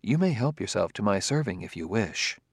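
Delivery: softly, under the table talk; discreet, hushed offer in a low voice. Acted. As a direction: keep it down, just for your companion. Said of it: "said in a low voice".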